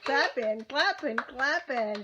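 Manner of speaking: loud chanted clapping, clapping, clapping; loud cheering. Real, not acted. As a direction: cheer the little singer on. Cheering child after song.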